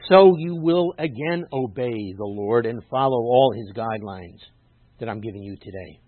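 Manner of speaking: weighty, forceful reading aloud; emphatic scripture reading. Acted. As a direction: declaim the sacred text deliberately.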